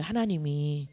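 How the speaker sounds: normal talking voice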